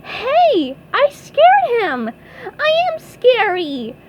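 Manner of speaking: high, loud delighted squeal; SpongeBob crying out. A narrator's reading. SpongeBob realises he scared someone, high excited cry. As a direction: crow it in a squeaky cartoon voice. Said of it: "cried SpongeBob".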